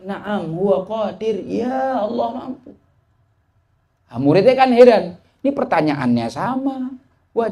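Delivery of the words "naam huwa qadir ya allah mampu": slow, a touch of tearful catch; answering in tears. Acted. The sheikh answers the drunkard a third time, mildly tearful. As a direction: let a mild sob colour the slow reply. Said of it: "sambil nangis mengatakan".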